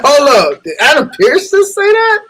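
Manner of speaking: high, loud stunned double take; shocked disbelief. Retold or imitated. Hearing Adam Pearce snap, high loud disbelief. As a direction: shout like you can't believe your ears.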